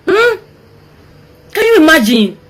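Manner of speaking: disbelief. Real, not acted. Disbelieving outcry.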